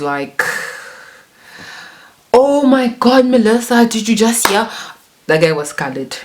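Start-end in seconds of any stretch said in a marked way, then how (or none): 2.3-4.7 put-on high, loud, scandalised Coloured accent; excited Coloured-accent gossip, pitched high; South African Coloured accent. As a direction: imitate a shocked friend in a Coloured accent. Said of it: "So yeah, the colored accent".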